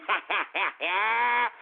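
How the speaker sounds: long cackle of delight; rolling, triumphant laugh; gloating laugh. Real, not acted. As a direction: laugh it up over the winnings. Gloats over making money.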